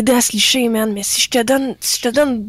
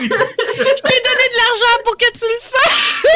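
laughing; 10 semitones higher, slower than usual. Real, not acted. Laughing protest after bribe.

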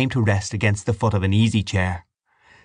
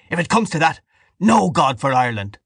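raised, defiant declaration; hoarse shout; 6 semitones higher, 3 dB louder than usual. A narrator's reading. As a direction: shout the challenge, slightly held back. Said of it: "he shouted hoarsely".

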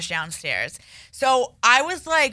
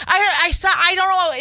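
high, teary, helpless; near tears; 10 semitones higher, 4 dB louder than usual. Retold or imitated. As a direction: squeak it out close to crying. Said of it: "on the verge of tears".

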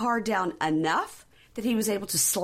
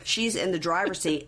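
ordinary talk, nothing marked

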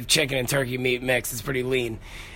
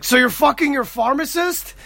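much higher, hammed-up disbelieving question; mock incredulous; 12 semitones higher than usual. To Brenda about Dwayne Reed, hammed-up high disbelief. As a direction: play the shocked boyfriend for laughs.

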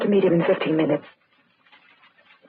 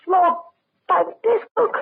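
frightened; 10 semitones higher than usual. Acted. Points out the body, frightened.

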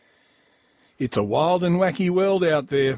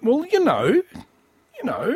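high mock voice; 13 semitones higher, much slower than usual.

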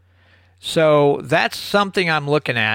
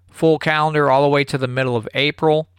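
ordinary talk

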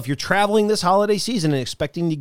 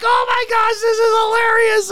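much higher, delighted squeal; giggling daughter's gleeful squeal; amused daughter. Retold or imitated. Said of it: "She's like".

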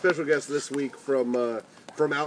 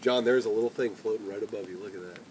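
soft; 8 semitones lower, 7 dB quieter than usual. Acted. Demonstrating the most effective voice.

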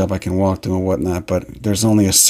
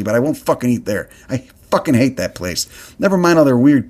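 ordinary talk

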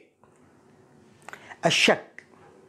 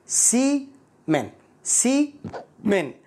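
"سيمن" very slow, split, higher pitch; syllable by syllable; 6 semitones higher, much slower than usual. Shown as a demonstration. Teacher breaking down "سيمن" for learners, slow and split. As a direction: break "سيمن" into two separated parts.

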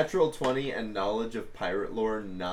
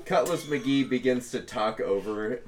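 ordinary talk

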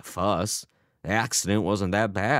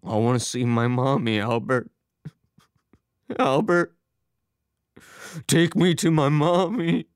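put-on childlike plea rising higher and louder; distressed, building to shouting; 8 semitones higher, 4 dB louder than usual. A narrator's reading. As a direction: beg for mommy, getting more frantic. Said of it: "His father began shouting".

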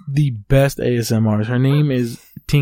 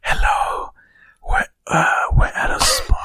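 whispered ASMR imitation. Imitating an ASMR host to a friend in a whisper. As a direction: breathe it out as a spa ASMR host. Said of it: "She'll be like".

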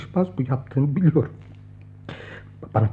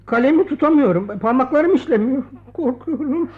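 panicked cry; 8 semitones higher than usual. Acted. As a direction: break out in fearful panic. Panicked confession he cannot write. (panik içinde, tiz haykırış)

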